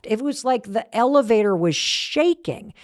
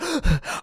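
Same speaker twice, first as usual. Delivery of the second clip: quick imitated gasp for air; breathless gasp; 3 semitones higher, 3 dB quieter than usual. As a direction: gasp like you just ran over. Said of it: "He's out of breath".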